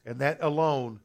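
soft, low prayer of surrender; serious, sincere prayer. Acted. Preacher models a salvation prayer for listeners, sincere and hushed. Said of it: "I'm serious".

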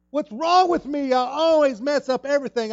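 high, loud anguished wail; despairing cry. Retold or imitated. Preacher voicing Peter's despair, loud anguished cry. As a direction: cry out like you've ruined it all. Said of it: "When I said that statement".

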